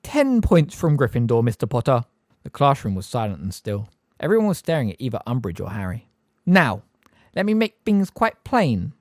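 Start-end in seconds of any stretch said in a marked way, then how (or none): 0.0-2.0 prim, raised teacher voice; a sugary stern schoolmistress docking points; Umbridge. A narrator's reading. 6.5-8.9 high, slow, prim schoolmistress; Umbridge. A narrator's reading. Umbridge lecturing the class.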